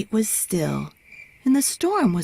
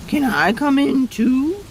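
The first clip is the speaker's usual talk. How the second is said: pleading cow; 6 semitones higher than usual. A narrator's reading. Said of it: "said Cow".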